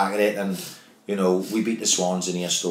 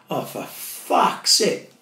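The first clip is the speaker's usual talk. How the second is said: exasperated; 9 semitones higher, much slower than usual. Retold or imitated. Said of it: "I'm going".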